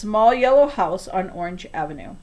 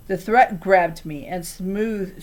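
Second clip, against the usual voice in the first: plain reading voice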